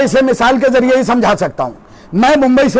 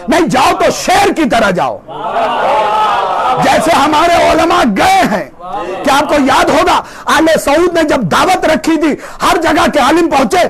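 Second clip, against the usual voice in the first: raised, shouted sermon voice; fiery preaching; 5 semitones higher than usual. Acted. Preacher rousing his congregation with a story, shouting.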